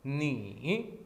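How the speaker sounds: नी with a dipping third tone. Shown as a demonstration. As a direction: curve the tone on नी. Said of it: "third tone". (नी, घुमावदार tone)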